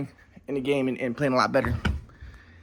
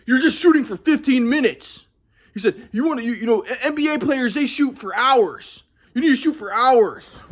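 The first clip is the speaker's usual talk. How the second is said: higher, slow preachy dad voice; lecturing dad; 6 semitones higher than usual. Retold or imitated. Son imitates dad lecturing about practice, higher and slow. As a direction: lecture like a demanding father.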